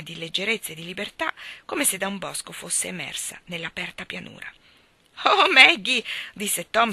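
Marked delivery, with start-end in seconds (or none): 5.2-6.0 loud, higher cry of delighted shock; gleeful boy's outcry; burst of glee. A narrator's reading. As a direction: cry out her name, bursting with fun. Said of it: "dal gran ridere".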